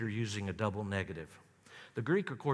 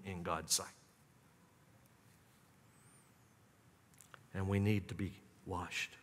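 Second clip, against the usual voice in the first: sincere hush; 3 semitones lower than usual. Real, not acted. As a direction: bring it home gently to each listener. Personal confession to congregation.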